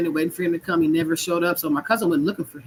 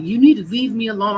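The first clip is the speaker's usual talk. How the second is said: raised voice, higher and louder; mild re-enacted yell, pitched up; retold yell; 5 semitones higher, 5 dB louder than usual. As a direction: retell a yell with some of its force. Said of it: "And I'm still yelling".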